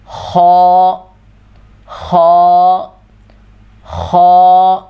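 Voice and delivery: خو with rounded lips, heavy and full; خو rounded and thick from the throat; heavy kho. Shown as a demonstration. Teacher showing the heavy letter خ, lips rounded. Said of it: "منہ تھوڑا سا گول ہوگا اور موٹی آواز".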